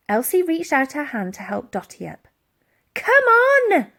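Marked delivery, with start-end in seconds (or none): 2.9-3.9 high, loud command; girl urging the old lady up; ordered. A narrator's reading. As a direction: urge her up. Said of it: "ordered the girl".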